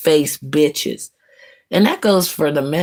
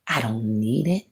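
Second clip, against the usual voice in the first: ordinary talk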